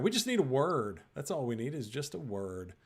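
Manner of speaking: normal talking voice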